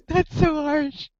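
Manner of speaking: real high-pitched, drawn-out shock; shocked gasp. Real, not acted. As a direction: gasp loudly, then protest slowly and high. Shocked at player shooting the puppy.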